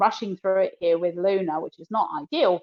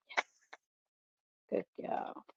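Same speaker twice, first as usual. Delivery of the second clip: whisper; 16 dB quieter than usual. Real, not acted. Quiet praise to dog.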